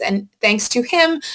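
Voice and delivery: ordinary talk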